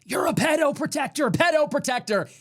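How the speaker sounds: high-pitched jeering imitation of a yell; mock heckler yell. Factory worker yelling up at Trump, mock high-pitched yell. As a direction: pitch it way up like a heckler, without full volume. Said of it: "yells up to him".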